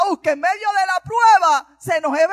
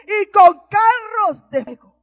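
fiery preaching; 3 semitones higher than usual. Acted. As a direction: preach it out to lift the congregation. Preaching at full force.

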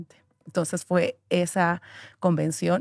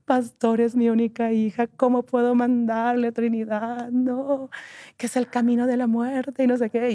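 tearful father; 6 semitones higher than usual. Retold or imitated. Father begging the pastor, higher and tearful. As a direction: beg the pastor as a weeping father. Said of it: "se pone a llorar".

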